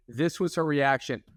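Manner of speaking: ordinary talk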